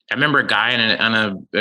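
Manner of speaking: ordinary talk, nothing marked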